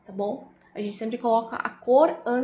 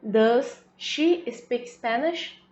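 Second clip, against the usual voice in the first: slow model sentence; much slower than usual. Shown as a demonstration. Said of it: "o auxiliar para she".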